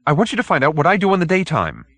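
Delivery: high and fast, rushed out in one breath; blurted out. Acted. Hoag blurting his request to the detective, fast and high. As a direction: get it out before you lose nerve. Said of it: "then blurted out".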